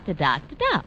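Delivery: ordinary talk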